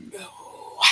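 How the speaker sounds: very soft, breathed-out no; hushed refusal. Retold or imitated. Asked to go in and save them, hushed refusal. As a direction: barely voice the refusal.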